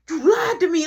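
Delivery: high, loud accusing yell; angry brother. Retold or imitated. Brother bursts into the room, yelling angrily. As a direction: hurl the accusation.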